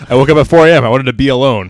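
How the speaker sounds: a hammed-up dad boasting about waking at four; gruff dad impression. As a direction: play the proud early-rising dad, booming. Dad impression, early riser.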